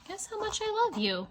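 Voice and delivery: high, sing-song title. Shown as a demonstration. Reading the title to a child in a high voice. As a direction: lift the title up high and playful. Title read two ways.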